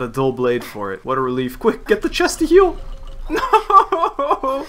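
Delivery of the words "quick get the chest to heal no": high, loud frantic yell; high-pitched wail of defeat; laughing panic. Real, not acted. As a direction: cry out in panic through laughter. Racing to heal, then dying.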